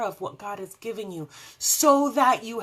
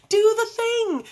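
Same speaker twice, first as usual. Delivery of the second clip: much higher and louder, cheering herself on; loud pep shout; 11 dB louder than usual. Acted. To the viewers about new classes, loud high cheer. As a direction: belt it like a cheer.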